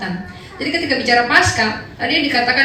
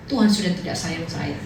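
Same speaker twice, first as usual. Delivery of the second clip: mild sulk; 7 semitones lower, 3 dB quieter than usual. Retold or imitated. Sulking believer quoted.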